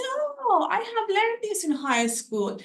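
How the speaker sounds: sudden high "Oh" of mock recognition. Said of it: "I say".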